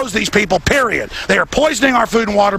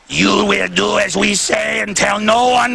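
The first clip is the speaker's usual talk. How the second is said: put-on slow, menacing Russian-style accent; drawn-out mock Russian accent; 2 semitones higher, slower than usual. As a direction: order them about in a put-on Russian accent. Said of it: "That's more like a Russian accent".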